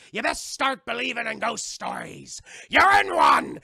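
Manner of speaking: building pirate shout, exploding at the end; Barbossa impression. Pirate captain warns Miss Turner, theatrical shout.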